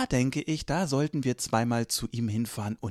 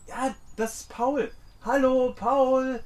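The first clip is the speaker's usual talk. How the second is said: laut und hoch gerufen; calling out; 12 semitones higher, slower than usual. Acted. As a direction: ruf langsam über die Ferne. Ruft Paul von weitem. (lauter Ruf über die Wiese)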